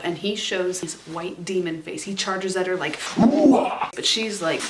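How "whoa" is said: high, loud imitated "whoa" roar; mock charging roar. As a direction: charge at her with a hammed-up yell. Imitates demon charging at her.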